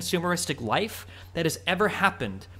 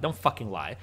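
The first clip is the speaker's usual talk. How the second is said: ordinary talk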